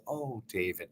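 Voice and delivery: mother hushing her worried son, gently; gentle, hushed Oh David. Retold or imitated. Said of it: "She was incredibly gentle".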